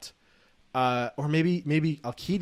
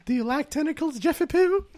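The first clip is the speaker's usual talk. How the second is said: flirty high voice; 13 semitones higher than usual. Acted. Flirting at Jeff in voice.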